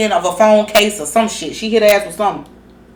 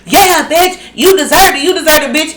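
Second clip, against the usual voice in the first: mocking taunt; 7 semitones higher than usual. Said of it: "he'll go on a dumbass".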